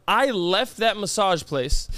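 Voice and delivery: usual speaking voice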